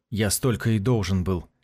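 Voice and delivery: plain reading voice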